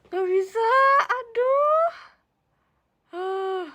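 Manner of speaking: high, loud playful wail; hammed-up wail of refusal to the viewers; mock wail. Real, not acted.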